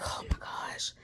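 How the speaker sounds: breathless whisper of alarm; hushed oh-no whisper; whispered panic. Retold or imitated. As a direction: whisper it like a sudden fright. Retold panic over car noise.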